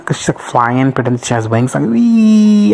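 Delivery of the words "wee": high, loud whee of joy; a loud, high mock whee; gleeful cry. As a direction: cry out in glee while flying. Flying witch quote.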